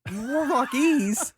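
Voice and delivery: high, loud, laughing disbelief; disbelieving shout with a laugh; incredulous. Acted. Incredulous at made-up language.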